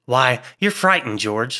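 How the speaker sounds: ordinary delivery, nothing marked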